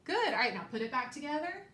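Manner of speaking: ordinary delivery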